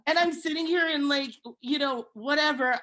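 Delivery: usual speaking voice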